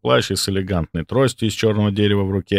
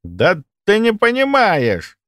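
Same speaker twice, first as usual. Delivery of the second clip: high, drawn-out indignant protest; slow, exasperated outburst; 11 semitones higher, slower than usual. A narrator's reading. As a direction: push back hard, pitch soaring. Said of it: "Он перешёл в наступление".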